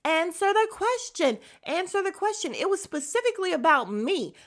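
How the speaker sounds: high-pitched, insistent mock outburst; raising the voice. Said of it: "this girl is raising her voice".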